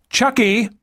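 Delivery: high yell over engine noise; shouting over machinery. A narrator's reading. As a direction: call out above the roar. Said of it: "the blonde shouted over the noise of the engines".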